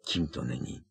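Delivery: low, soft, sighed-out reply; a lovesick boy sighing to his friend. A narrator's reading. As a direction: sigh it out heavily, lovesick. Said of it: "vzdychl znovu Ron".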